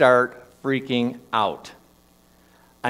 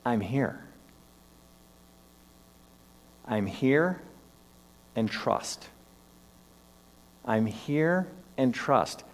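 soft inner voice; 7 dB quieter, much slower than usual. Retold or imitated. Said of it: "this voice came inside of my mind".

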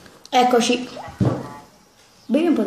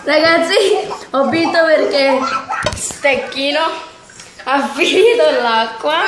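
laughing; 5 semitones higher, 3 dB louder than usual. Real, not acted. Announces win laughing.

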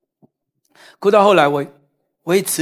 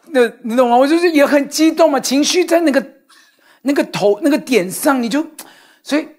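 normal talking voice

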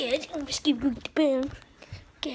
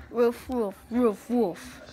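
barked woofs imitating a dog; dog bark imitation; 2 semitones lower, much slower than usual. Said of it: "he barks at me".

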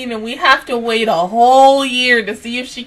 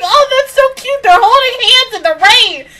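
high, quick-hearted squeal of delight; mock-gushing, pitched way up; 10 semitones higher, 5 dB louder than usual. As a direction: imitate yourself cooing over a cute picture. Retells gushing at a cute picture.

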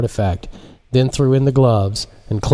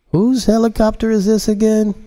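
surprised man voice; 12 semitones higher than usual. Acted. Jacinto asks in story.

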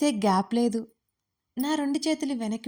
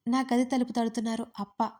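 plain reading voice

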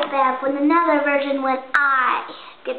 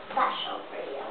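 whispering; 9 dB quieter than usual. Real, not acted. Picking makeup secretly.

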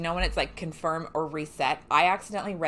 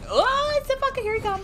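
much higher, louder panic; high panicked cry as the enemy rushes in; 7 dB louder than usual. Real, not acted. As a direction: yelp as the boss charges. Enemy charging her.

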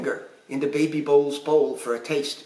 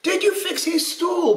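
very high, insistent question; high-pitched storybook demand; 14 semitones higher than usual. A narrator's reading. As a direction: demand an answer as pirate papa. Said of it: "Papa demanded".

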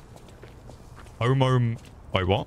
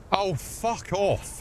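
stretched out slowly, exasperated; drawn-out fuck off; 10 semitones higher, much slower than usual. Real, not acted. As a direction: drag the words out in disgust. Yelling at a police enemy.

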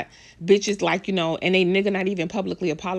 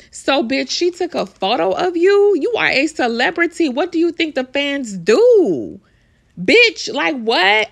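loud and much higher, incredulous; outraged disbelief; 7 semitones higher, 7 dB louder, slower than usual. Real, not acted. Vlogger ranting at the celebrity over a fan photo, loud outrage.

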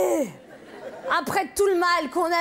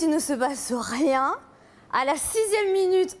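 ordinary talk

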